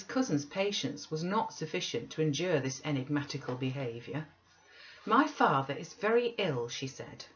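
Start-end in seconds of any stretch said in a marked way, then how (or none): 5.0-6.7 raised, louder and indignant; a young woman calling him to account; indignant girl. A narrator's reading. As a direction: demand it of him. Catherine voiced, demanding.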